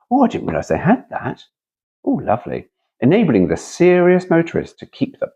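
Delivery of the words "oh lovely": ordinary delivery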